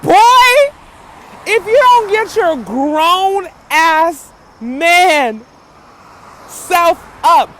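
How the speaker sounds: loud, high, disbelieving tell-off; a slow, loud, incredulous rant; exasperated scolding. Retold or imitated. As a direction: chew out a grown man acting like a child. She acts out scolding [name].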